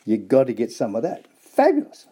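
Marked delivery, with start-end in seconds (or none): 1.4-2.1 much higher and louder; excited. Real, not acted. Presenter raving about Aussie beef jerky, excited. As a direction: burst out with delight.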